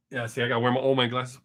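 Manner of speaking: usual speaking voice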